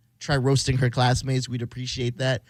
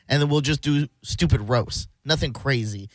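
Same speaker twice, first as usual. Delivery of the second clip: ordinary delivery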